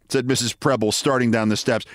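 usual speaking voice

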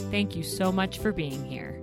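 ordinary talk, nothing marked

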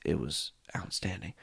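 breathy hushed whisper of delight; a soft reverent whisper about the food. Real, not acted. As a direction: whisper it like a secret treat. Savoring the dinner memory.

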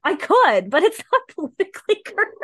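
high, fast giggling; laughing squeal. Real, not acted.

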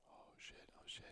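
whispered, hushed curse; awed whisper. Retold or imitated. Friends watching the snake stalk the rat, awed whisper. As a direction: breathe it out unvoiced, too scared to be heard. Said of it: "And everyone's like".

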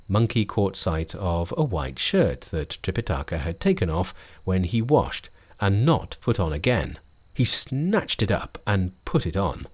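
plain reading voice

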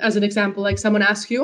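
ordinary talk

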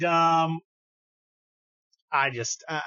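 normal talking voice

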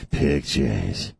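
low breathy leering voice; creepy voice. Pretend. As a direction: drop low and leer it. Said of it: "This is my creepy voice".

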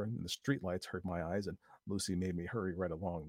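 plain reading voice

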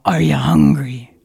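deep, loud growling challenge; Les Brown growl. Retold or imitated. Motivational speaker growling at the crowd, deep and loud. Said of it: "that growl".

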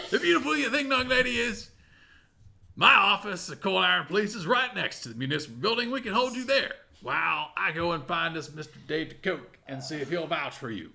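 put-on high, loud old-timer lawman; old prospector. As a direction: boom it out like an old deputy. GM retakes Bob voice.

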